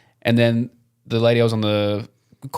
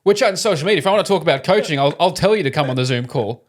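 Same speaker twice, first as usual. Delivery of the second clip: mock Lockie rant; 6 semitones higher than usual. Imagining telling Benji off mid-meeting, high mock rant. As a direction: playfully shoo him off the call, pitched up. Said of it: "Hey, man".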